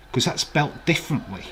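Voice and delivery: ordinary talk, nothing marked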